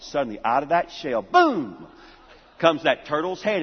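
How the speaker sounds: ordinary talk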